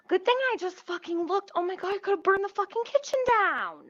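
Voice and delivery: high, fast rush of shaken relief; real alarm. Real, not acted. Cook who nearly burned the kitchen down, alarmed. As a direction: rattle it out high at the near miss. Realises near kitchen fire.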